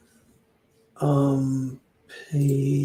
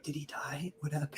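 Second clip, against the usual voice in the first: whisper; 12 dB quieter than usual. Retold or imitated. Waking to sudden silence from snoring.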